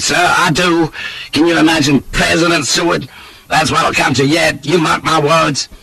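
high, vehement voice of a political grumbler; worked-up Southern diner, pitched high; irate Southern gentleman. A narrator's reading. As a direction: rail against a rival with relish.

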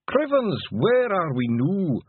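high, slow Feegle brogue; broad Scottish accent. Acted. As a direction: wee Scots pixie voice. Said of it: "said Daft Willie".

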